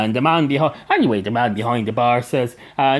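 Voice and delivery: ordinary delivery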